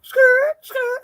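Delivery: high loud skirt-skirt screech imitation; tire screech. Imitating police cars screeching to a stop. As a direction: mimic squealing police tires.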